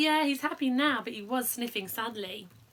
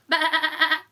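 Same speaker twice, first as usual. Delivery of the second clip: loud mock goat bleat; 5 semitones higher, 10 dB louder than usual. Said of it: "bleating loudly".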